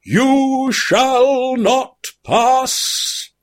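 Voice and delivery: slow, high mock-epic bellow. A narrator's reading. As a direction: drag out each word like a wizard's decree. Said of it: "bellowed at the Isengard".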